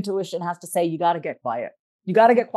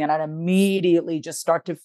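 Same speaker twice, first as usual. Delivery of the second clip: ordinary delivery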